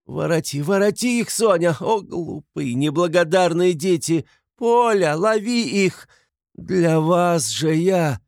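high, loud desperate cries; frantic calling. A narrator's reading. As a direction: scream after fleeing children, dragging the last words. Mother chases runaway children, crying out. (отчаянно кричит вслед, высоким голосом)

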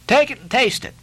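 high, emphatic command; insistent order. A narrator's reading. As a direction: push the cook to obey. Mate insisting on his order.

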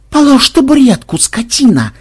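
high, loud anguished order; tearful shout. A narrator's reading. Madam seeing her furniture used as a weapon, tearful shouting. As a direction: cry out high, close to tears. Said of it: "со слезами в голосе крикнула мадам".

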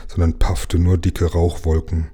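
ordinary delivery, nothing marked

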